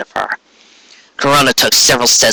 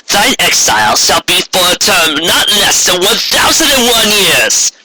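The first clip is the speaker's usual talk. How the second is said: Corona pronouncing exile; 8 semitones higher than usual. Acted. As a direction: banish her sister to the moon. Corona banishes Luna.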